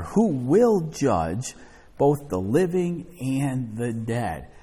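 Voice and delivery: slow, solemn read, every word weighed; slow down on "who will judge"; slow emphasis. Shown as a demonstration. Preacher pointing the congregation to judgment, slow and solemn. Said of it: "Now notice what he also says here".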